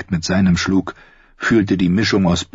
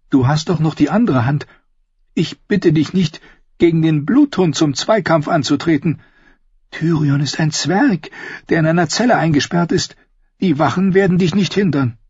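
much higher woman's voice, pressing; insistent Cersei; 11 semitones higher than usual. Acted. Sister pushing her brother to kill Tyrion, high insistent voice. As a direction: press him urgently in a high voice.

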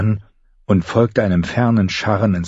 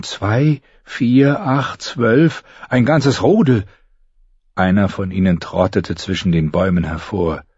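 plain reading voice

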